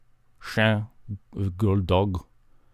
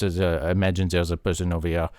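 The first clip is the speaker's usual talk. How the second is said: normal talking voice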